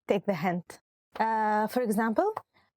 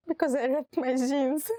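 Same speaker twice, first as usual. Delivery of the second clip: mock crying; 4 semitones higher than usual. Why are you crying?.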